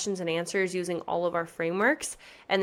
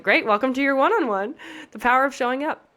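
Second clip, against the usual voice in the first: louder, higher mock-announcer welcome; upbeat greeting; 7 semitones higher, 6 dB louder than usual. Coach welcoming the only client on the group call, upbeat. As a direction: greet them with bright, raised enthusiasm.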